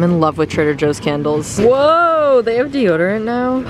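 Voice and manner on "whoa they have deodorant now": light up at finding it; delighted surprise at the deodorant shelf; surprised. Real, not acted. Spots new product in store.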